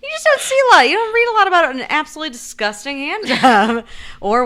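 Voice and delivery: laughing. Real, not acted. Laughing over hand jobs.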